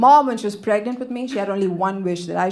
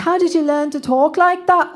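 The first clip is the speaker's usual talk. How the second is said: higher-pitched mock British accent; 7 semitones higher than usual. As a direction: ask in an exaggerated British accent. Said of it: "speak with a British accent".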